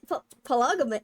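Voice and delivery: ordinary delivery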